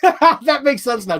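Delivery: said through a laugh, high and slow; amused, drawn-out laughing line; laughing realization. Real, not acted. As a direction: burst out laughing as it sinks in.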